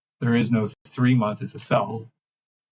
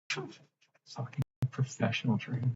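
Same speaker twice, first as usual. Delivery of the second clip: muttered curse; 11 dB quieter than usual. Real, not acted. Muttered aside.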